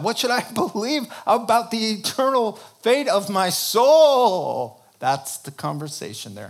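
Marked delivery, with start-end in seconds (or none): 0.0-4.8 desperate outcry. Acted. Voicing the disciples' desperate question.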